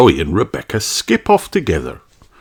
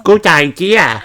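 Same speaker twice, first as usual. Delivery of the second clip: high little sheep voice with a laugh; happy agreement; 9 semitones higher than usual. A narrator's reading. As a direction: agree with a giggle. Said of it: "says Susie".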